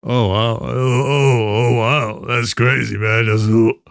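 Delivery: very low mock deep voice; deep voice impression. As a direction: imitate the fake deep voice. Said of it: "a million clones of this guy".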